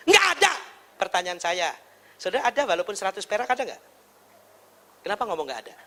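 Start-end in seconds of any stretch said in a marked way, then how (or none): 0.1-0.5 high, emphatic mock refusal; cross mother. Mother refuses child's plea for money, angry scolding. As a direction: cut the child off sharply.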